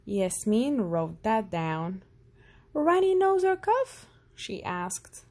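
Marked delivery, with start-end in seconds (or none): 2.7-4.1 put-on high, louder child voice; girl playing doctor; little doctor asking. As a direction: quiz him like a keen young doctor.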